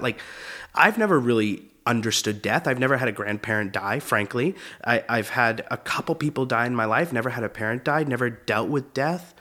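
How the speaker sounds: normal talking voice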